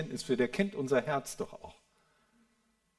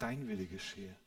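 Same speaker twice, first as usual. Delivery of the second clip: hushed surrendering prayer; 8 semitones lower, 10 dB quieter than usual. Retold or imitated. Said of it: "Aber was hat er gesagt?".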